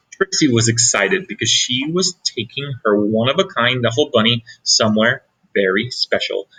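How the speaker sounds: ordinary delivery, nothing marked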